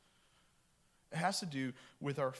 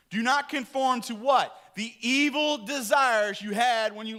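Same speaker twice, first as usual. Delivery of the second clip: loud, high, driving sermon; preaching at full force; 10 semitones higher, 11 dB louder than usual. Acted. Pastor preaching loudly on obedience. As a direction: preach it hard to the congregation.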